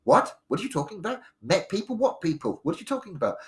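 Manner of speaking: a worried mother demanding answers; alarmed mum. Retold or imitated.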